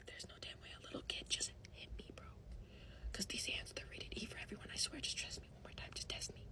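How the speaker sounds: unvoiced, very quiet seething whisper; whispered threat. Hit by a little kid, she talks to herself, whispered threat. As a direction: whisper a warning under your breath.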